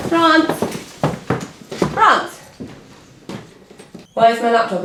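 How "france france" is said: high call across the room. Real, not acted. Calling across the office for France. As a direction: yell his name to find him.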